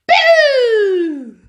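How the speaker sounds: loud high shouted boo; big scare shout. Acted. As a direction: yell boo to startle. Cub scares killer whales.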